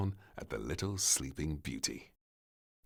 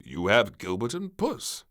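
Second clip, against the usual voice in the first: king; 4 semitones higher, 7 dB louder than usual. Acted. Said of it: "said the king".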